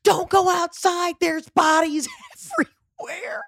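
high, half-whispered panicked warning with a breaking laugh; mock panic, voice cracking into a laugh. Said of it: "in a fucking panic state".

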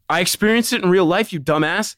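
high, loud exasperated comeback; indignant retort. Retold or imitated. Speaker snapping back at his friend over crying, indignant.

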